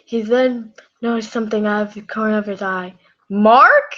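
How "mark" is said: put-on loud, rising Irish-accented call; attempted Irish accent. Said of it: "I can't do an Irish voice".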